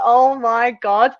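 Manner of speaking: loud, high, slow panicked oh my god; nervous gasp. Retold or imitated. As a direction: blurt it out in nervous shock. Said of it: "I was like".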